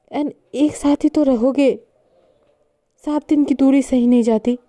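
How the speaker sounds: राग भरी ऊँची आवाज़ में दादी की डांट; angry scolding. A narrator's reading. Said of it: "दादी को ऐसे चिल्लाते हुए". (दादी की गुस्से वाली चिल्लाहट)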